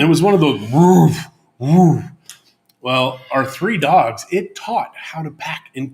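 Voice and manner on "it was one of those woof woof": playful bark imitation.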